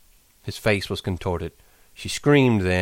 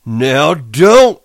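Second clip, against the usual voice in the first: angry; 6 semitones higher, 11 dB louder than usual. A narrator's reading. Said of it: "Then Lenny grew angry".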